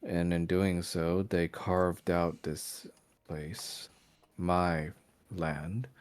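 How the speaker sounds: brooding vampire lord. Acted. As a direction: lay out the old grievance slowly, weighing each word. GM voices the vampire lord's speech.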